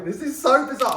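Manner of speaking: high, loud burst of disbelief; real astonished outburst; amazed. Real, not acted. Amazed at finding old things.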